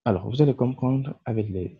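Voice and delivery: ordinary talk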